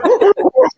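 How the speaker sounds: sharp, high, loud gasp; excited gasp. Retold or imitated. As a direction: gasp as if gripped by the race. Reacting to exciting races.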